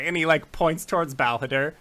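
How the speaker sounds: ordinary delivery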